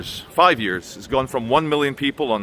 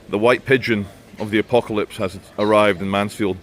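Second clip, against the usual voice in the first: ordinary talk, nothing marked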